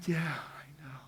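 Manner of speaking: near-whispered, soft reassurance; hushed comforting. Retold or imitated. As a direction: whisper it gently to someone in tears. Comforting a crying bereaved woman.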